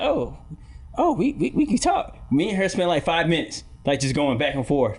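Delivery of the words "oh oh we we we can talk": mock British. Teacher keeps accent going.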